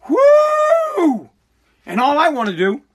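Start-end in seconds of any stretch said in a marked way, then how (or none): 0.1-1.3 high, loud exasperated whoo shout. Real, not acted. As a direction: let it all out in one high whoo. Venting frustration over piled-up troubles.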